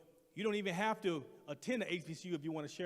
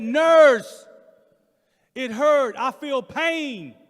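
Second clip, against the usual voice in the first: much higher, louder pained cry; desperate cry; 7 semitones higher, 19 dB louder, much slower than usual. Retold or imitated. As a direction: wail it out, really hurting. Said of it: "I said".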